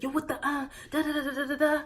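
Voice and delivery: high, loud, blustering rant; hammed-up argument yell; mock heated yelling. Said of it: "I am not the calmest person".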